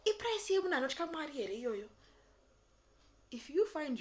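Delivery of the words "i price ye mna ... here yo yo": much higher, louder whiny complaint; hammed-up shrill moan; mock Facebook complainer. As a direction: play the outraged commenter. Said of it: "I hear someone say".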